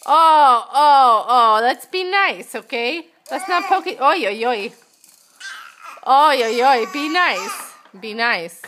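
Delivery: mild scolding. Real, not acted. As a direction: warn the babies gently but firm. Mother scolds babies poking.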